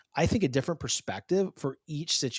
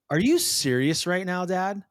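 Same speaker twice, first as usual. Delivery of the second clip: higher, louder mock disbelief; incredulous daughter; 7 semitones higher, 5 dB louder than usual. Daughter challenges her dad, mock incredulous. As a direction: imitate a kid challenging Dad.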